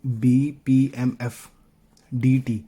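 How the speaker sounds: letters spelled out slowly, one by one. Shown as a demonstration. Teacher reading the letter list slowly to students. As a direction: say each letter slowly and clearly. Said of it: "हमने ये देखना है".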